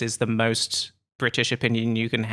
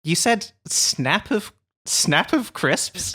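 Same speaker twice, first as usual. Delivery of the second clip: slow, disbelieving echo; incredulous repeat; 7 semitones higher, much slower than usual. Retold or imitated. Teasing a co-host over his phrase, incredulous. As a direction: drag it out like you can't believe it. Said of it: "teasing quote".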